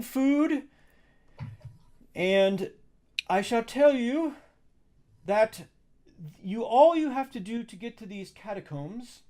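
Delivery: high-pitched, courteous servant guiding guests; high formal helper's voice, pausing between phrases; eager servant Adjunct. Acted. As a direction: play an obliging attendant, pitched well up. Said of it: "And Adjunct says".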